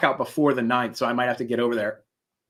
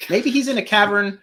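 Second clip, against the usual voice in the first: ordinary talk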